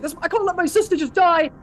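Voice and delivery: high, loud, desperate outburst; losing his mind. Acted. Warrior restrained while his sister is in danger, desperate cry. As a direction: cry out as if beside yourself. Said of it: "like, losing his mind".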